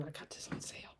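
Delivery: secret whispered aside. Real, not acted. Friend confiding the hoodie's sale price, whispered.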